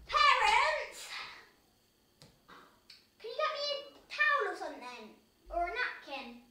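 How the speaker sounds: high, loud call to parents; yelling across the room. Real, not acted. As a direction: shout it out to another room. Calling parents from another room.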